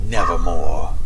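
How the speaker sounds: low croaking raven voice. Acted.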